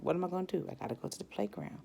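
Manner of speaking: ordinary talk, nothing marked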